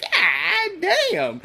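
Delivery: high, amazed exclamation; mock amazed outburst; awed god damn. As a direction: gasp it out in awe. Friends awed arriving at Bel Air.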